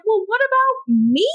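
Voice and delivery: high-pitched, put-on whine of the one left behind; hammed-up feeling of being forgotten; mock indignant plea. Acts out Rebecca being left behind.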